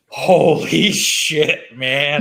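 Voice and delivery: laughing out loud over it. Real, not acted. Reacting to a terrible Boston accent, laughing.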